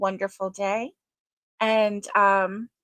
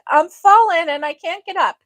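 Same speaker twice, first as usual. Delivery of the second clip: higher, louder mock cry for help; playful voice for Carl; 7 semitones higher, 7 dB louder than usual. Streamer voicing Carl who nearly jumped ship, playful mock distress. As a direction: pipe up as the toppled Carl. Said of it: "He was like".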